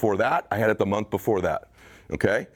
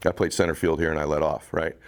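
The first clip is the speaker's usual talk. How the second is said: usual speaking voice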